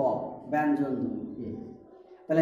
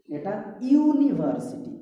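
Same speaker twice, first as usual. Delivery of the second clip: "University" said slowly and higher, stressing "ইউ"; slow, stressed yoo; 5 semitones higher, slower than usual. Shown as a demonstration. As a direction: draw out "university" leaning on "ইউ". (ধীরে "university", "ইউ" জোর দিয়ে)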